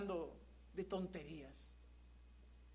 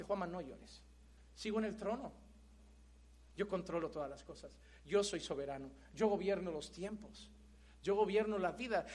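tender voice of God; 4 semitones lower, 5 dB quieter than usual. Acted. God consoling Juanma after bad news, soft and low. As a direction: lower and softer, calm and sure.

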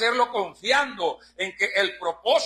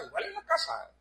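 "igual en la casa" repeated much higher and softer; echoed much higher; 9 semitones higher, 7 dB quieter than usual. Shown as a demonstration. Preacher echoing his own point to the brothers, higher and softer. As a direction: echo "igual en la casa" up high, quietly. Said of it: "repeated phrase".

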